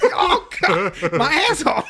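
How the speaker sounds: very high, loud, laughing cry; boy in pain. Retold or imitated. Boy crying out as fire shoots again, very high and loud. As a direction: howl in mock pain. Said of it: "more fire shoots".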